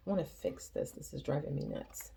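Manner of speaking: low unvoiced mutter to herself; frustrated aside. Real, not acted. Reader fiddling with her recording setup, muttering in frustration. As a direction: grumble it under your breath, almost whispered.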